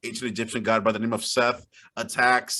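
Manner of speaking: ordinary talk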